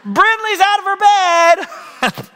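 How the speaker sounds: put-on a little boy tattling at the top of his voice downstairs; mock child shouting out a tattle; child yelling. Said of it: "So Charlie yells to us downstairs".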